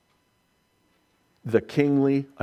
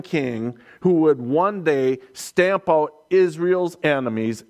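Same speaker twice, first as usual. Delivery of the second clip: louder, higher rousing sermon voice; preaching at full force; 4 semitones higher, 4 dB louder than usual. Acted. Preacher proclaiming the Messiah to the congregation, rousing. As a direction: proclaim the coming king.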